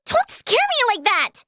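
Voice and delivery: high-pitched protest after a fright; startled scolding. Acted. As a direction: scold while still rattled. Scolds someone after a scare.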